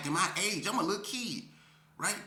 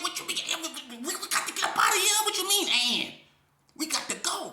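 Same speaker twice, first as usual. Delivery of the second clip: high, loud and fast, near panic; frantic pleading; 12 semitones higher, 4 dB louder than usual. Retold or imitated. Pleading with Bread to flee the gang: frantic and loud. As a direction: rush the words, begging him to move.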